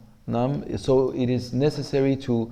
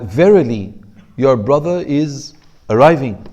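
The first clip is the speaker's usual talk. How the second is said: "Verily" louder, the rest slower and weighty; emphatic; 5 dB louder than usual. Shown as a demonstration. Teacher shows student how to insist, loud and slow. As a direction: press "Verily" hard, then drag out the line. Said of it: "You are going to emphasize".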